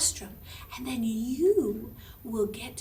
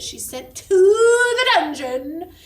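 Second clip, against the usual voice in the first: dramatic emphasis; 6 semitones higher, 13 dB louder, slower than usual. A narrator's reading.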